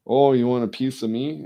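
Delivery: half-joking bar-fight threat, loud and high; mock tough challenge. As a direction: dare him to step up. Imagined comeback to critics.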